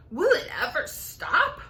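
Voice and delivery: mild shout. Acted. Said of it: "Aunt Sponge shouted".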